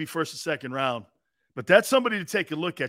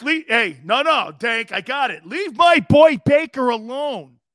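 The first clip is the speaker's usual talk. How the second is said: higher, louder mock protest; co-host mocked defending Baker Mayfield, raised and loud; mock Dank; 5 semitones higher, 7 dB louder than usual. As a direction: jump in to defend your boy. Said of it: "Dank's like".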